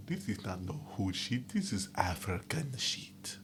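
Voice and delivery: hushed put-on African accent; whisper. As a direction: breathe it out as a secret. Whispered aside in persona.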